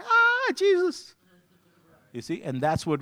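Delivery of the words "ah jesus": high, loud startled yelp; alarmed cry. Retold or imitated. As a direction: shriek it out in shock. Sin creeps in, alarmed cry.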